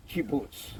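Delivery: normal talking voice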